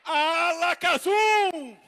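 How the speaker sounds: loud slow incantation; booming magician's command; shouted spell. Acted. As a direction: shout it to make the magic happen.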